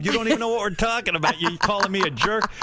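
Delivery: high-pitched retold outrage; indignant. Son answering the mother who called him jerk, indignant. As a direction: protest in comic disbelief, pitch jumping up.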